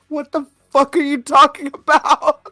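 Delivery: flabbergasted. Retold or imitated. Reliving his reaction to the coworker, flabbergasted. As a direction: wail it in baffled amusement. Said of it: "I was, like, flabbergasted".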